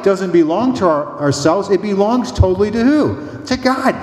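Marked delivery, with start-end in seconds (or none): 1.7-3.9 climbing preacher question. Real, not acted. Preacher's rhetorical question and answer.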